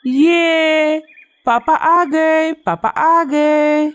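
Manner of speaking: put-on high, drawn-out child cheer; cheering chicks. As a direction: stretch out a gleeful high-pitched hooray for Papa. Chicks cheer father's return. (चूज़ों का ऊँचा, लंबा खुशी भरा नारा)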